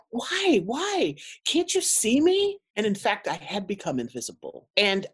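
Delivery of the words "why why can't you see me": high, pleading inner cry; inner plea. Retold or imitated. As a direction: beg to be seen, pitched up. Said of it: "in my mind, I was like".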